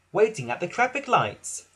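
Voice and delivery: usual speaking voice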